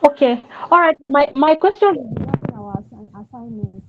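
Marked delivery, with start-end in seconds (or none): 1.9-3.8 faint, fading voice; too quiet to hear on the call; barely audible. Real, not acted. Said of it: "Your voice is very low".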